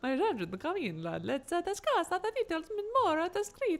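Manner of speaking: high-pitched, hammed-up vampire accent; botched Italian turned cartoon vampire. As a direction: play a campy vampire, voice climbing high. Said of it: "Now I'm sounding like a vampire".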